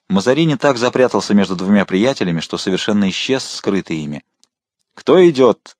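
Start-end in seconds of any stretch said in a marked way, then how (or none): 4.9-5.8 louder and higher, impatient Кто идёт; impatient repeat. A narrator's reading. Patrol chief repeating his challenge, impatient and louder. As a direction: demand it again, losing patience. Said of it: "с нетерпением повторил тот же голос".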